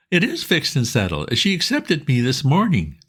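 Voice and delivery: pleading pal, keen and urgent; eager Bingo. A narrator's reading. Bingo asking his old friend Bertie for help, eager and high.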